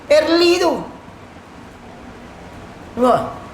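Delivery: ordinary talk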